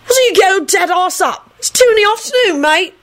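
high-pitched, exasperated scolding; yelling back. A narrator's reading. Alex telling Clive to get up, yelling. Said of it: "Alex yelled back".

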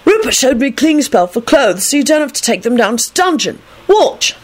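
put-on high, eager boy voice; happy announcement. A narrator's reading. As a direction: show off excitedly. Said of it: "announced happily".

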